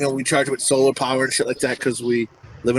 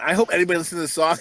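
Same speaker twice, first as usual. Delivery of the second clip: ordinary talk, nothing marked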